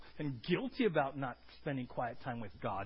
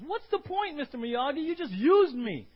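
high, loud whiny protest; imitating the angry Karate Kid complaining; frustrated complaint; 11 semitones higher, 12 dB louder than usual. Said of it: "and he goes".

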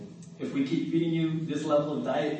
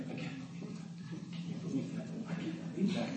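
a voiceless, much softer mutter to oneself; mumbling under breath; 4 semitones higher, 14 dB quieter than usual. Retold or imitated. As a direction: grumble it under your breath, stewing over a grudge. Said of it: "mumble under your breath".

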